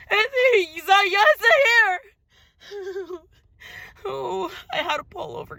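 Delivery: high, cracking voice, sobbing through the words; breaking into tears. Real, not acted. Spiritual confession on camera dissolving into real sobs. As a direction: let the tears take over mid-sentence.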